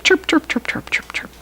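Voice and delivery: cicada chirp. Shown as a demonstration. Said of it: "and chirp".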